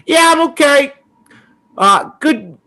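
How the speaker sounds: put-on high, loud kid reply. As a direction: play a brave little kid. Kid answering after a fall.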